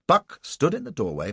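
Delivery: plain reading voice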